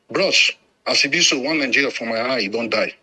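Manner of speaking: ordinary talk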